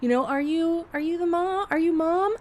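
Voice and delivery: high, smiley greeting to an upset parent; friendly defusing. Retold or imitated. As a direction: put on a sweet, high voice to keep things calm. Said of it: "diffuse the situation".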